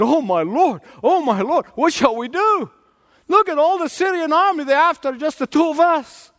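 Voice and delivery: put-on high, rising cries of a terrified young servant; panicked servant. Servant panics before enemy army.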